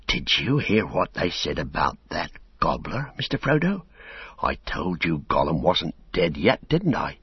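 ordinary delivery

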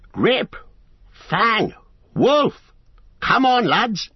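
high, sharp calls to the dogs; shouting. A narrator's reading. Farmer setting his dogs on strangers, shouting. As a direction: call out each name high and forceful. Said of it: "a loud voice was heard shouting".

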